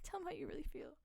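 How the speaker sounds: fast whispered encouragement; whispering. Pretend. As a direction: hurry the advice in a whisper. Whispered advice voice in skit.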